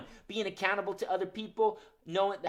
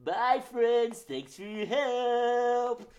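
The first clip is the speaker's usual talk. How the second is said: puppet goodbye voice; 7 semitones higher, 4 dB louder, much slower than usual. Acted.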